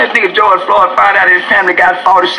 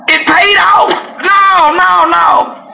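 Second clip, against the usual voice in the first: excited shout; 13 semitones higher, much slower than usual. Acted. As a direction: shout it like you won big. Celebrating the payout.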